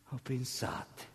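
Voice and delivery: hushed whispered plea; whispered Oh, pensate. Acted. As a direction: breathe it as a secret.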